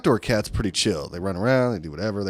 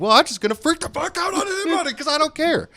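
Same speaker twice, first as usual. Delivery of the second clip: high, far-off parody voice; mock crazy customer. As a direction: ham up the unhinged shopper. Mocking yelling stay-at-home mom.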